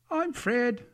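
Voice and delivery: high, cheery call; cheerful character voice, very high; happy voice. A narrator's reading. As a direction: sing out happily, pitched right up. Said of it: "said a happy voice".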